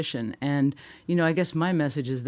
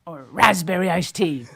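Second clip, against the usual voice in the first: pirate voice; 4 semitones higher, 5 dB louder than usual. Pretend. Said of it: "talk like a pirate".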